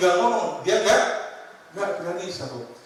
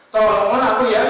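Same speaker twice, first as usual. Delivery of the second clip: a loud begging cry; preacher acting a loud cry for help; loud plea; 5 semitones higher, 9 dB louder than usual. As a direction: call out for help, pleading. Preacher voices desperate plea.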